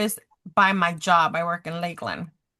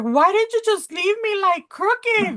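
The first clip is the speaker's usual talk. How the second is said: put-on high, crying plea of a hurt little girl; crying child; 12 semitones higher, 3 dB louder than usual. Daughter in pain asks her mom why, crying. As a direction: voice the daughter sobbing and accusing. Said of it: "she's crying and she's like".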